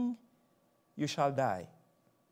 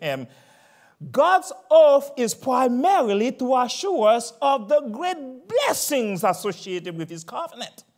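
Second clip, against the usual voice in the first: louder, higher pulpit voice; preaching at full force; 6 semitones higher, 7 dB louder than usual. Acted. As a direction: preach it out, slow and strong.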